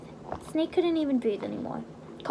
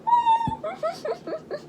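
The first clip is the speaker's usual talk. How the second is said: high laugh. Real, not acted. Reader laughs at himself.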